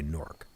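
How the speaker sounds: Newark squashed into one syllable, NORK; local Jersey pronunciation. Shown as a demonstration. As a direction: say Newark as NORK, low and soft. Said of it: "our accent pronounces it as N O R K".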